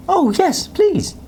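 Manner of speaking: put-on very high, excited little boy; eager high yes please; eager child. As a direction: beg happily like a thrilled kid. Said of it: "replied Jack".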